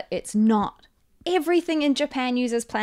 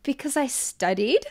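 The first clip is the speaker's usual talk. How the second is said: mock-exasperated retort, climbing higher and louder; sarcastic comeback; 6 semitones higher, 6 dB louder than usual. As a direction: throw the question back in disbelief. Sarcastic comeback to repeated question.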